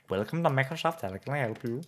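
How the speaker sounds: amateur attempt at an Indian accent. Streamer playing Microsoft support for a viewer, in an Indian accent. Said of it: "but in an Indian accent".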